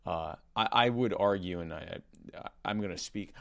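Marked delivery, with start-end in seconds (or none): none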